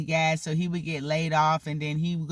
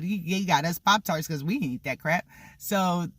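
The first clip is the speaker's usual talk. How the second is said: usual speaking voice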